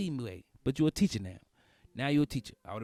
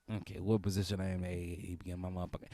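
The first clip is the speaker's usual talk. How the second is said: ordinary talk, nothing marked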